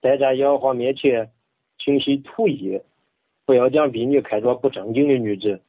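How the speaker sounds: Bible reading in Henan dialect; measured Henan dialect recitation. Shown as a demonstration. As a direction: read scripture in a rustic Henan accent. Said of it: "用河南话".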